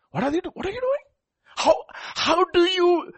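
high incredulous what are you doing; shocked disbelief, voice jumping up. Pretend. Incredulous rebuke repeated.

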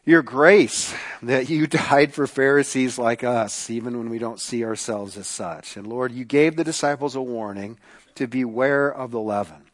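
low, soft praying voice; prayer. Real, not acted. Leads an opening prayer.

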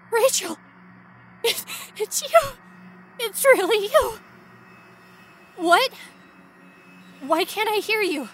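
high, loud cries of joyful disbelief; excited shout. Acted. Calling out to Rachel trapped behind glass, high excited shouts. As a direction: yell her name, overjoyed then baffled.

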